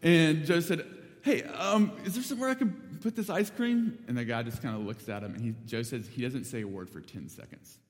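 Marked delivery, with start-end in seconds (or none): 1.2-4.0 nervous, higher-pitched attempt at casual; trying to be chill. Joe asks the silent old man about ice cream, trying to be chill. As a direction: imitate someone playing it cool, pitched up. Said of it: "trying to be chill about it".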